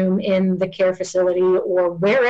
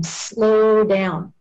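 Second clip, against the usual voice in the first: slow, drawn-out words. Shown as a demonstration. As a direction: stretch both words out unhurriedly. Demonstrates slowing down while saying it.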